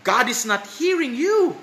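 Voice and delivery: imitated taunting verdict; mock chiding. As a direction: jeer it as a mocking unbeliever would. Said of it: "They are chiding the Christians".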